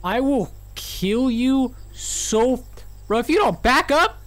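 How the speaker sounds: half-joking slow threat building; mock threat. Real, not acted. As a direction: threaten her, then yell. Threatening creepy NPC.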